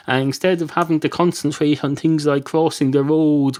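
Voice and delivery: usual speaking voice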